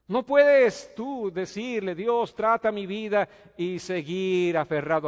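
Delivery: loud, slow, drawn-out proclamation; loud slow preaching. Acted. Preacher warning the congregation, loud and slow. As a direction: pound out each word of the warning.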